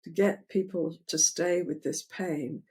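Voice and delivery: usual speaking voice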